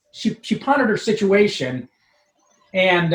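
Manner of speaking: ordinary talk, nothing marked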